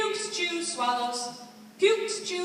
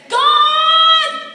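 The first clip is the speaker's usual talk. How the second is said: calling out to God; 12 semitones higher, 17 dB louder than usual. Acted. David calls for God.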